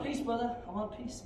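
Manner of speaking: lightly acted placating voice; pleading peacemaker. Instructor acting out calming an attacker, mock pleading.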